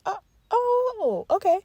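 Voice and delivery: high, startled "oh, oh, okay"; mock surprise. Narrator learning he waits outside, high startled reply. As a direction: sound caught off guard.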